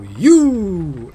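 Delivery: loud, high, drawn-out "You!" swooping downward; booming slogan voice. Retold or imitated. Commentator gives in to his brain's slogan, booming announcer voice. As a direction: boom it out like a lottery advert. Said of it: "a booming voice would go".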